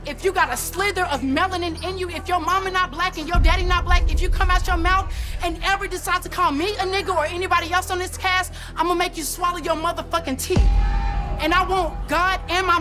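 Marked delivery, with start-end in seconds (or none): none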